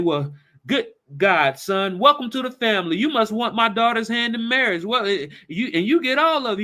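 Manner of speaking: high, delighted welcome; excited. Retold or imitated. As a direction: gleefully welcome him. Father welcoming son-in-law.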